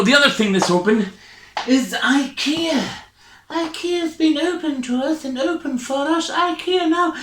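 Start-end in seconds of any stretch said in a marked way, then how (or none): 1.7-7.1 hammed-up wonder; mock reverent. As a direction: praise ikea like a holy place. Reverent build-up of IKEA.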